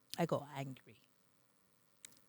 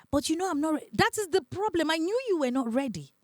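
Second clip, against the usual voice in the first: pitched up, louder, protesting; a voice raised high in protest; exasperated retelling, higher and louder; high excited protest; 10 semitones higher, 5 dB louder than usual. Retold or imitated. Voicing boyfriend's excuse.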